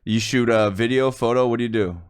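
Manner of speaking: ordinary talk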